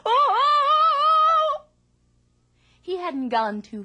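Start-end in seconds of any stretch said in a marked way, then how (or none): 0.0-1.6 very high, loud frightened wail; a panicked howl while running; terrified cry. Acted. Man flees in terror.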